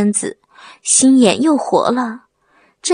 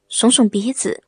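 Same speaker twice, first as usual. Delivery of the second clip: ordinary delivery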